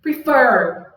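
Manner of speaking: Prefer, light pre and heavy fer; stress on fer. Shown as a demonstration. Teacher demonstrating the stronger syllable, fer stressed in Prefer. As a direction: punch the second syllable of Prefer. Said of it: "fer tá bem mais forte".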